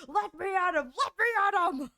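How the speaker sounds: mock yell. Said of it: "He's like".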